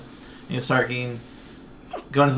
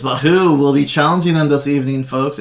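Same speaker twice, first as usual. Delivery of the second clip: arena announcer; 2 semitones higher, 7 dB louder than usual. Acted. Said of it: "He goes".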